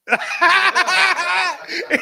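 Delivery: high, loud laughing fit. Real, not acted. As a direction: burst out laughing. Laughing at the story.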